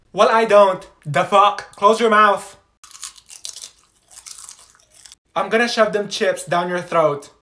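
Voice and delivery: loud furious yelling; angry yelling. Acted. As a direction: snap at her, loudly. Yelling at chewing classmate.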